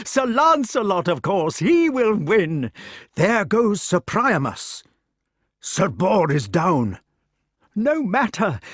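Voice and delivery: high, eager king cheering; an old king carried away by the fight; excited old king. A narrator's reading. Arthur cheering the battle.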